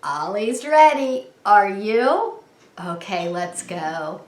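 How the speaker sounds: usual speaking voice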